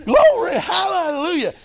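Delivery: excited shout of glory; joyful praise. Real, not acted. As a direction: cry out praise in delight. Praise on hearing the news.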